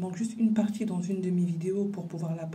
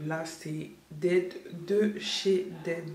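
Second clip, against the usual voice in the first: slow drawn-out; much slower than usual. Real, not acted. Exhausted aside about no time.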